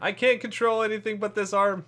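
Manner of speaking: frustrated loud outburst; exasperated yell at the controls; loud complaint. Real, not acted. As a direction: shout it in exasperation. Co-op octopus game, frustrated outburst.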